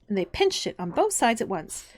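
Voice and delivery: usual speaking voice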